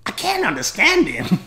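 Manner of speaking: put-on high, loud child's wail; little American girl. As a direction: complain in a piping little-girl voice. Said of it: "little American girl".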